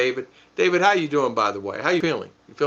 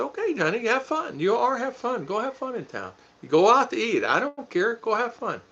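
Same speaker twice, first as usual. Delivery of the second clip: sweet send-off; 9 semitones higher than usual. Retold or imitated. Husband telling his wife to go have fun in town, high and doting. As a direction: pitch up and coo her out the door.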